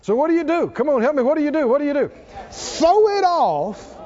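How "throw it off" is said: slow, high, stretched-out exhortation; emphatic preaching cry. Acted. Pastor urging the congregation to throw off burdens, high and drawn out. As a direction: draw it out like a rallying call.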